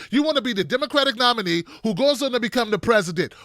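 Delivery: ordinary delivery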